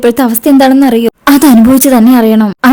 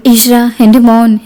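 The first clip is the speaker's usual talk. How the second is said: plain reading voice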